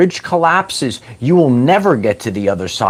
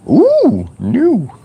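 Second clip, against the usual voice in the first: delighted ooh; 11 semitones higher than usual. Pretend. Savouring the new-car smell.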